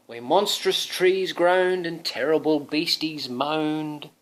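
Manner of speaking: slow, dramatic groaning forest; spooky storybook. Acted. Father reading to Thomas, spooky storybook voice. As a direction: make the woods a little scary.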